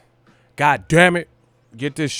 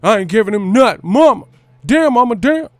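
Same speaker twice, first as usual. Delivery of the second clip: loud, higher-pitched rapper impression; 8 semitones higher, 7 dB louder than usual. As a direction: punch it out like a hard West Coast rapper. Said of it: "gotta say it like [name]".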